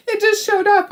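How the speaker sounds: high, through real laughter; amused laughing. Real, not acted. Crafter telling of a surprise payment, laughing. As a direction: let the laugh carry into the words.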